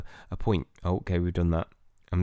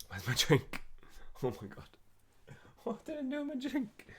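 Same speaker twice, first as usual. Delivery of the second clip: much higher, much softer, panicky squeak; sudden alarm over a lost drink; panicked; 14 dB quieter than usual. Real, not acted. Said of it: "I panicked".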